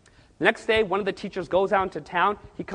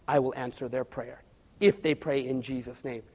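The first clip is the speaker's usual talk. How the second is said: lower, softer, solemn divine voice; voice of God; 5 semitones lower, 5 dB quieter than usual. Retold or imitated. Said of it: "God was telling him".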